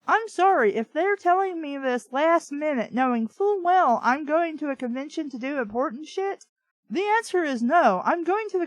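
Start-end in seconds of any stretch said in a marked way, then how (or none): none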